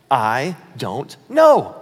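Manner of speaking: "I don't know" drawn out very slowly, weight on "know"; key phrase stressed. Shown as a demonstration. Pastor spotlights the key phrase for listeners, very slow. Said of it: "the key phrase there?".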